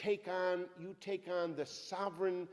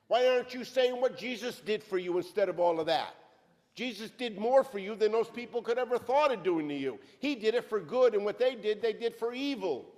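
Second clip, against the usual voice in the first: raised, driving preacher's voice; fiery preaching; 2 semitones higher, 4 dB louder than usual. Acted. Preacher exhorting the church, full-force preaching. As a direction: preach it hard to the congregation.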